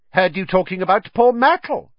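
high, loud, mischievous taunt; gleeful poltergeist. A narrator's reading. Poltergeist teases about poor Myrtle, mischievous. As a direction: needle them with loud glee. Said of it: "said Peeves, his eyes dancing".

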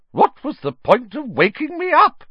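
a very high, loud, grumbling call; grumpy Fat Lady. A narrator's reading. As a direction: complain shrilly after him. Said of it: "the fat lady called grumpily".

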